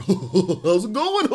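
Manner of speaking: slow, laughing high mock greeting; mock awkward greeting; goofy guy's greeting. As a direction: play an awkward guy laughing at him. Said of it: "guys go like".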